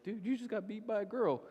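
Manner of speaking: a hint of a smug kid ribbing his buddy; mild mock jeer at a loser; teasing teen. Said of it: "and he's like".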